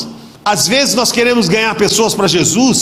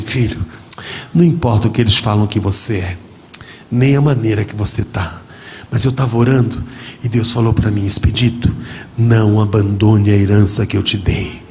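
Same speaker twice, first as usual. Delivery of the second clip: solemn father; 10 semitones lower than usual. Retold or imitated. Father relays God's message.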